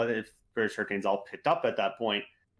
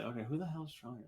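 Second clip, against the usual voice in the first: inner voice; 2 semitones higher, 11 dB quieter, faster than usual. Retold or imitated. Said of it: "I was in my head, I was like".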